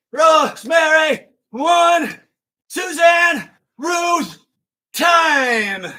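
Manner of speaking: high, loud coach shouting a countdown; calling out. Real, not acted. Instructor counts down the final reps by name, calling out. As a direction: shout encouragement over the class.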